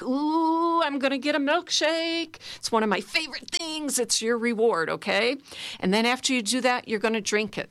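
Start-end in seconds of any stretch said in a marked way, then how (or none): 0.0-4.9 put-on high, gleeful five-year-old voice; childlike joy. Coach shows the class a kid's joy, gleeful and high. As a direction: squeal it like a kid about to get a treat. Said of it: "have joy in that".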